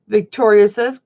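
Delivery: usual speaking voice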